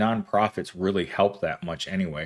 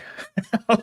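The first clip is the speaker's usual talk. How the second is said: high laugh; 11 semitones higher than usual. Real, not acted. Laughing at chat joke.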